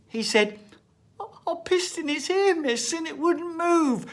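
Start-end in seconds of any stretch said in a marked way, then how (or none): 1.2-4.1 high, loud, quick schoolboy voice; little boy voice. Retold or imitated. Boy repeating himself to his teacher, high and eager. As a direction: rattle it off like an eager little lad.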